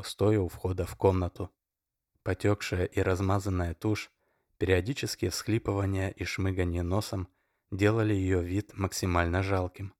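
plain reading voice

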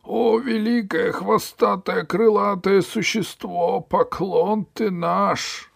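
high, slow wailing flattery; grovelling cry. Acted. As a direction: drag out each word begging. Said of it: "кричал он".

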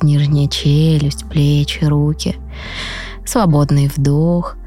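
soft, slow meditation voice; calm guide talking them into rest; guided meditation. Acted. Leads a guided breathing meditation.

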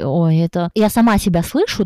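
ordinary talk

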